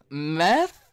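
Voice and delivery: put-on a first, lighter [name]-style "Meth?" with a rising tune; mock rapper "Meth?" rising up. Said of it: "[name] voice".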